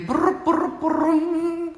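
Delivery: high buzzing car sound; engine noise. Pretend. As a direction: make a bumper car go brr. Bumper cars noise.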